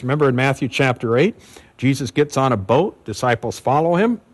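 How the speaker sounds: ordinary delivery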